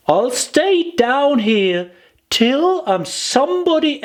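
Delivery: put-on high childlike voice, close to tears; a little girl talking herself into staying put; high little girl fighting back tears; Alice, tearful. A narrator's reading.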